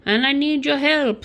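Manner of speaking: scam-email prince. Acted. Scammer quoting a begging email to a victim, mock princely plea. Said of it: "those Nigerian email scams where it would say".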